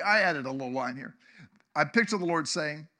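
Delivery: ordinary talk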